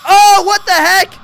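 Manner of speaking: high loud slow shocked cry; shocked yell. Real, not acted. Jump scare, loss in game.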